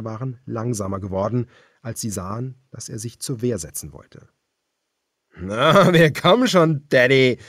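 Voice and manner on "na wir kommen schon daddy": higher, louder mocking sneer; a leering villain calling out; taunting cannibal leader. A narrator's reading. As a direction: taunt him like prey. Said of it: "sagte der große Mann".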